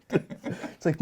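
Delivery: usual speaking voice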